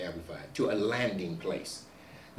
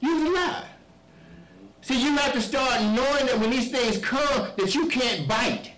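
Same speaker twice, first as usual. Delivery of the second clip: loud, high-pitched sermon cadence; fiery preaching; 12 semitones higher, 6 dB louder than usual. Acted. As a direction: preach it hard to the congregation. Preaching at full force.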